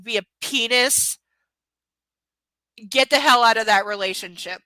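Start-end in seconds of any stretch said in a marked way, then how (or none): 2.9-4.6 loud and blunt; emphatic. Real, not acted. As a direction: tell them straight, loudly. Mocking advice to viewers.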